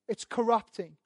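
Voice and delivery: ordinary delivery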